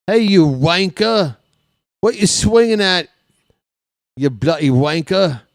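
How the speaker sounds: loud, high British heckler; British-accented heckle. Acted. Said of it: "heckle Jazz in a British accent".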